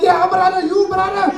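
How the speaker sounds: high, loud calling from the taxi door; taxi guard yelling. Retold or imitated. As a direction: yell it out like he's hanging off a moving taxi. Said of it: "He's like".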